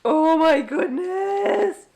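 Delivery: high and slow cooing; slow high-pitched gush. Real, not acted. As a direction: savour each word like melting. Overwhelmed by cuteness.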